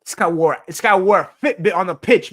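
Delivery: fed-up fan yelling at the screen; exasperated outburst. Real, not acted. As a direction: blast it out in sheer disbelief. Fan ranting about a player.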